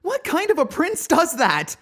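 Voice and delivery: furious accusing. Acted. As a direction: hurl the accusation at him. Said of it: "fury lacing through my fear".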